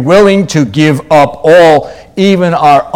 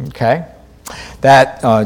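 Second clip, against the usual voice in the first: usual speaking voice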